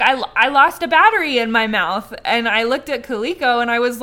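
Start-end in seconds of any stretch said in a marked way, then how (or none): 0.0-2.1 put-on repeating it louder, childlike; insistent little kid, louder; child voice.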